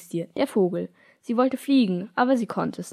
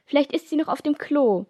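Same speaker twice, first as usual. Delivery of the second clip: joking jibe; 6 semitones higher than usual. Acted. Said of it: "witzelte Helene".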